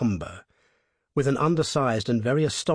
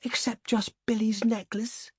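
high, timid little plea; anxious young woman's small cry; pleading cry; 10 semitones higher, 3 dB quieter than usual. A narrator's reading. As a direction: ask for it meekly, high-pitched. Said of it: "cried Hilda".

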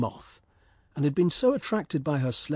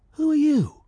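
high, louder startled swallow; swallow asking; 14 semitones higher, 6 dB louder than usual. Acted. Swallow to the weeping statue, high startled. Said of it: "he said".

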